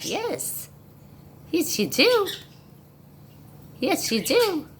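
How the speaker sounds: high doting pet voice. Real, not acted. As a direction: coo at the dogs like babies. Praising the dogs.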